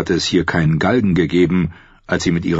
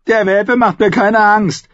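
mocking squire; 5 dB louder than usual. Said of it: "begann der Junge".

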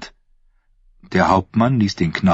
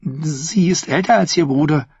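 patronizing; 9 semitones higher than usual. Acted. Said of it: "als wäre er irgendein törichter Narr".

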